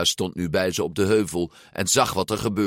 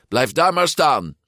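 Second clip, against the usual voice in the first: raised, higher urgent call; 8 semitones higher, 5 dB louder than usual. A narrator's reading. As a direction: shout an instruction across to a friend. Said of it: "riep hij".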